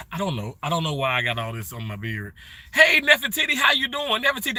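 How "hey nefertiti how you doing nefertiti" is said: high, bright shout of a hello; excited greeting. Real, not acted. Streamer greeting a viewer in chat, high and excited.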